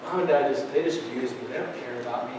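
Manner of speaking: put-on hushed, hurt kid's complaint; exasperated child. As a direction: let it sink to a wounded murmur. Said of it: "They're getting exasperated".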